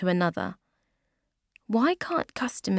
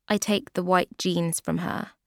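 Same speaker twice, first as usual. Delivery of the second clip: plain reading voice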